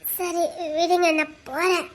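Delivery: soft, slow plea to be let go; quiet reluctance. Acted. Woman asking her admirer to let her leave, softly reluctant. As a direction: say it small and slow, well under normal volume.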